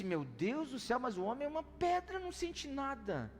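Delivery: lightly acted astonishment at a stone-faced man; astonished. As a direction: marvel aloud that the man feels nothing. Pastor's astonished inner thought.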